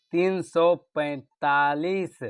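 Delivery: very slow, drawn-out mnemonic; measured, word-by-word dictation; slow emphasis. Shown as a demonstration. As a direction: stretch each word so it is remembered. Teacher spells out mnemonic number slowly.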